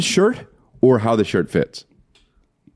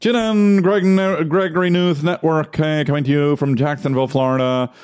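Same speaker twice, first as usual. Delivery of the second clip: high-pitched, oddball news intro; newscaster impression; 5 semitones higher than usual. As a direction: announce it like an odd amateur newscaster up high. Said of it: "when you do the impression".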